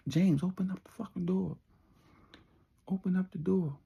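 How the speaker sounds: impatient calling out. Retold or imitated. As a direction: yell it, losing patience. Billy banging, demanding door open.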